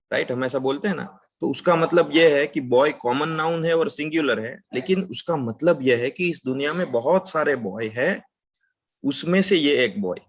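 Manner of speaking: ordinary delivery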